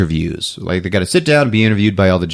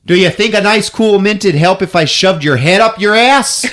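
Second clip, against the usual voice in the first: mock angry retort; 9 semitones higher, 3 dB louder than usual. Stunned Loretta snaps at candy striper.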